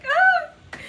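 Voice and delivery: very high, loud anguished cry; despairing wail. Real, not acted. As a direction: wail out in helpless distress.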